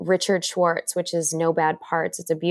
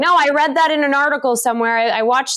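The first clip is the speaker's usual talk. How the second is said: higher, hurried mock self-justification; mock know-it-all brush-off; 5 semitones higher, 4 dB louder than usual. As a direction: imitate someone briskly waving away their feelings. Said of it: "because we're like".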